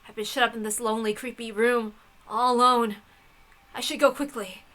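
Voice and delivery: ordinary delivery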